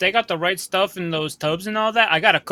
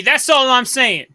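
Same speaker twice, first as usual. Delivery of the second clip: high, loud, drawn-out protest; pushy insistence, pitched up; exasperated protest; 4 semitones higher, 5 dB louder, slower than usual. Acted. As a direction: throw up a loud in-character defence. Character protests to the captain.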